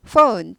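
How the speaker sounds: "phone" with a clear F and an "oh-oo" vowel; F-sound phone. Shown as a demonstration. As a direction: bite the lip for the F in "phone", then glide "ou". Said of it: "F 발음이 나죠".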